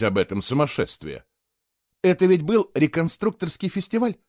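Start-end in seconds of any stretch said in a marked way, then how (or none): 2.0-4.2 high, hopeful asking; boy hoping it was just a festival; hopeful question. Acted. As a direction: clutch at a last hope. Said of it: "с надеждой спросил он".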